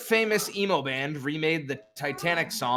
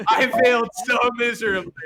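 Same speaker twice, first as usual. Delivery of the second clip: higher, louder, quick through laughter; laughing admission; 6 semitones higher, 6 dB louder, faster than usual. Real, not acted. Quizmaster owning up to the failed trick, laughing. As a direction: admit it while cracking up. Cracking up over hidden song.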